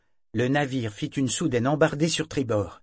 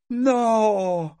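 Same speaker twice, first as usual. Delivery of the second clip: a sudden high howled refusal, much louder; howling no; 12 semitones higher, 7 dB louder than usual. A narrator's reading. Parangon refuses the men, howling. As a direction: scream it out. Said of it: "hurla Parangon".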